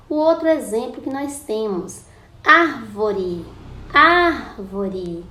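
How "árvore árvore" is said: ÁR in "árvore" held long and loud, then falling; stretched, louder first syllable ÁR in "árvore"; calling out the word. Shown as a demonstration. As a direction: call out "árvore", leaning hard on ÁR. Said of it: "Quando você chama a palavrinha árvore".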